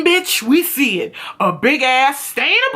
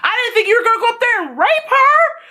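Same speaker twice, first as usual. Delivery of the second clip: mock accusing Miles; 6 semitones higher than usual. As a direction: confront him, rising into a rushed accusation. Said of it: "He says to him".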